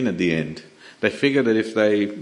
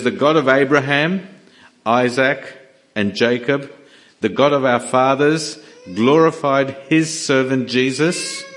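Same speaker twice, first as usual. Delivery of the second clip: proclaiming scripture; 3 semitones higher, 4 dB louder than usual. Retold or imitated. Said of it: "He says".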